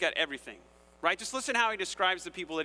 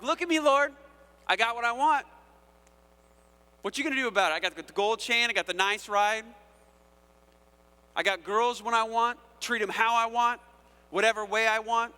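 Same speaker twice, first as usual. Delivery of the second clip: cocky taunt at heaven, higher and louder; arrogant boast; 5 semitones higher, 5 dB louder than usual. Acted. The wicked boasting to the Lord, cocky and loud. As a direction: strut it, mocking God to his face.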